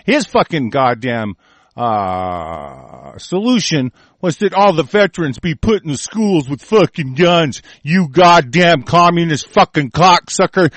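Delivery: ordinary talk, nothing marked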